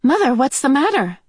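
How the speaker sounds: high, anxious cry; worried girl. Acted. Meg to her shaken mother, high anxious cry. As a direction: call out in alarm. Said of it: "Meg cried".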